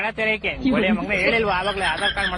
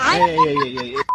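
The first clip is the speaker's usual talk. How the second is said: excited whoops; 4 dB louder, much slower than usual. Acted. Cheering in fan chant.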